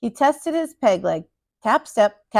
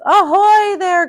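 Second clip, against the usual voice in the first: high, slow, loud hail from above; calling out; 9 semitones higher, 8 dB louder, slower than usual. A narrator's reading. Pirate hails the captain from the branches, loud and slow. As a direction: hail them from up in the tree. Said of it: "called a voice".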